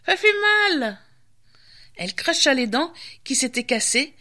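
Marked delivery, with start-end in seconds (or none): 0.0-1.0 high, wailing witch voice; witch wailing in pain. A narrator's reading. The witch after breaking her stumps, high wailing complaint. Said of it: "hurla-t-elle".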